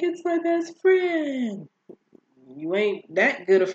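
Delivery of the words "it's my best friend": very high, stretched-out mock whine; mock panicked whine. Vlogger mimicking Cassie fretting about her best friend, mock panicked whine. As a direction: wail it slowly, hammed-up and high.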